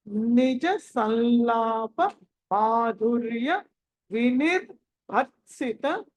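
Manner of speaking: slow verse reading, word by word; slow model recitation. Shown as a demonstration. Teacher reciting for students to read next, slow and measured. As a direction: stretch the long vowels, pause after each word. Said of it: "ఎలా పలకాలో చెప్తాను".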